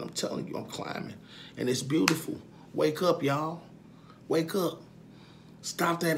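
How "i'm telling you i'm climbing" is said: whispered affirmation. Real, not acted. Man affirming his growth to viewers, whispered. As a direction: breathe it out like a secret. Quiet intense self affirmation.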